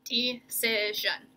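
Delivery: "Decision" slow and evenly segmented; syllable by syllable. Shown as a demonstration. Teacher showing pupils the breaks, "Decision" in slow syllables. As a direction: sound out "Decision" slowly in parts. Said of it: "you guys can see how we're breaking it".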